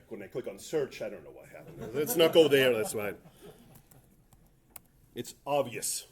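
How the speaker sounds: ordinary delivery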